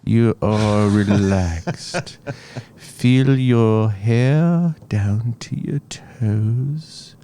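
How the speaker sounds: slow, soothing meditation guide, mocking; slow mock relaxation guide; guided meditation voice. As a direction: parody a meditation recording, slowly. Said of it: "you hear the guy's voice".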